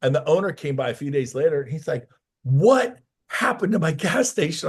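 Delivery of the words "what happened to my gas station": retold boss, higher and louder in disbelief; astonished boss. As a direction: play the owner stunned by what he sees. Owner amazed at clean station.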